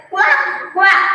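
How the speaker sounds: teacher's playful duck quack. Shown as a demonstration. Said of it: "he only said".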